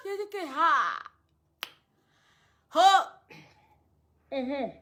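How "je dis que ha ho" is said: ordinary talk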